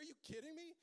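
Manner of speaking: high, incredulous cry; outraged disbelief. Acted. Groom hears his best man will fast, outraged disbelief. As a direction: shriek it in disbelief.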